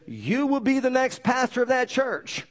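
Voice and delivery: high, slow, commanding proclamation; the voice of God, slow and high, calling out; booming voice of God. Retold or imitated. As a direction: announce it slowly like a voice from heaven. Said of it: "a voice, louder than what I'm speaking".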